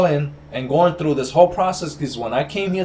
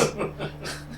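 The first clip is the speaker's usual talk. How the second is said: breathy laugh; 9 dB quieter than usual. Real, not acted. Laughs at the news.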